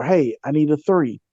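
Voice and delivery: normal talking voice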